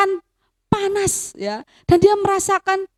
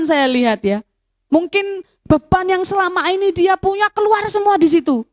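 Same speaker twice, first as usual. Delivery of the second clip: ordinary delivery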